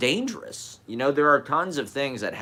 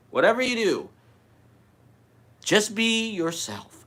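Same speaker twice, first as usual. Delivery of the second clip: guru voice; 12 semitones higher, 5 dB louder than usual. Pretend. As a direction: intone it like a wise guru. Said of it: "As a guru".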